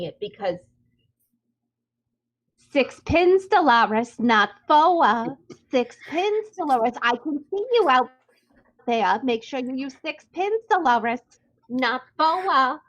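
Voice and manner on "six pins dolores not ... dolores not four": put-on Dolores's accent. Reader imitating how Dolores talks in the audiobook, in her accent. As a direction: mimic the narrator's regional accent, punching key words. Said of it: "in the accent. He writes the accent".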